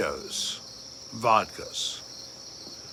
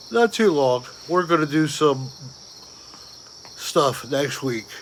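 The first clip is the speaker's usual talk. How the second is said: speaking through a yawn; stretched yawning words; 2 semitones higher than usual. Real, not acted. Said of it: "I'm yawning".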